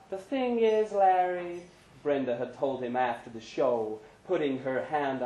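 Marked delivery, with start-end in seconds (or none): 0.1-1.6 Brenda's voice. A narrator's reading. Brenda confiding in Larry after the show. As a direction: coax him gently.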